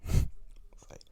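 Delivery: hissed, menacing boss threat; menacing Vince impression. Imitating Vince threatening the referee, hissed and loud. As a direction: hiss it like a villain boss, then bark. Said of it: "He said".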